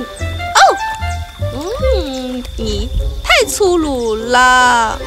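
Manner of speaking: high, curious little bee. Acted. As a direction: chatter to her own reflection. Maya talks to reflection.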